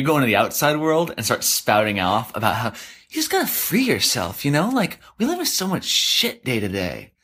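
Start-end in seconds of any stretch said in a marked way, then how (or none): 2.7-5.8 high, sing-song preachy mock; mock a smug self-help guru. [name] mocks preachy tidying talk.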